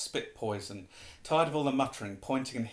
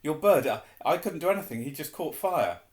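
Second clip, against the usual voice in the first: loud, raised, flustered protest; gasped panic; 4 semitones higher, 6 dB louder than usual. A narrator's reading. Harry explains the burning bird to his professor, gasped panic. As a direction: blurt it out in alarm. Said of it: "Harry gasped".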